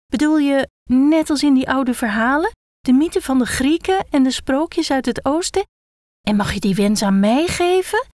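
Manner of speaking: put-on a child who can't believe its luck; eager excitement. Head excitedly imagines the wish.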